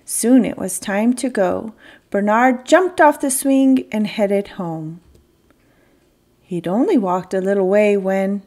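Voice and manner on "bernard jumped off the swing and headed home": ordinary delivery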